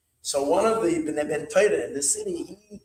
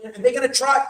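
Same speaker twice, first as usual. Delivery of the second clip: rising urgent; 6 semitones higher, 5 dB louder than usual. Retold or imitated. Rebbe urging him on.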